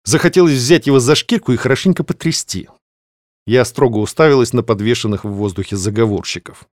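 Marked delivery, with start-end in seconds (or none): none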